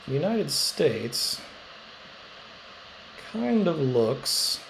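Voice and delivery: very slow, with long suspenseful pauses; drawn-out reveal. Acted. Teacher sharing a weird map thought with class, slow suspenseful reveal. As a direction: stretch each phrase and hold the punchline back.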